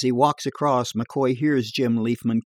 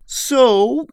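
higher, louder bar yarn voice; tall-tale barfly; 12 semitones higher, 7 dB louder than usual. Acted. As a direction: spin a dirty joke in a bar. Edgar voiced.

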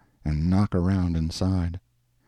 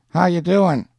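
raised, higher street greeting; calling across; 10 semitones higher, 5 dB louder than usual. A narrator's reading. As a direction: call out loudly to someone across the way. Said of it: "he called across to Schultz".

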